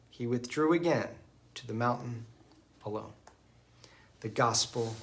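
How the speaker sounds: solemn scripture reading from the ambo; gospel proclamation. Acted. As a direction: read it out as at Mass.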